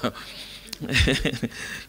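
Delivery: breathy chuckle trailing off; amused laugh. Real, not acted. Preacher winding down after selfie joke, breathy laugh.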